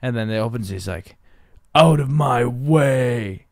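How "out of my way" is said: put-on slow, loud, gruff drunk man's bark; slow and louder, as a big drunk; a drunk man ordering someone aside, slow and loud; big deep voice. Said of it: "in the biggest, deepest voice possible".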